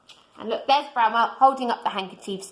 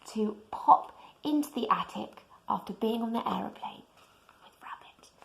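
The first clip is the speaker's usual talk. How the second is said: whisper; 8 dB quieter than usual. Real, not acted. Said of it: "reading aside softly to child".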